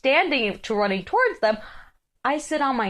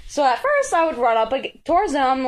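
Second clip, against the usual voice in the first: normal talking voice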